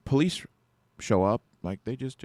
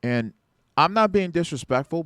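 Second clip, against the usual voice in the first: firm, raised; 5 semitones higher, 7 dB louder than usual. Retold or imitated. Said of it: "I'm being firm now".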